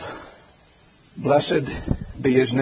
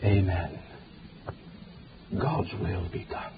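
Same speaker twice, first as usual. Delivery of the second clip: resigned amen; 10 semitones lower, 11 dB quieter than usual. Acted. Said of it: "your mom and dad ... are going to say".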